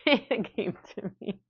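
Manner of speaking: giggling through the words; laughing. Real, not acted. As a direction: laugh at your own joke. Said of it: "I have fun all by myself".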